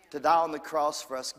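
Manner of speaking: ordinary delivery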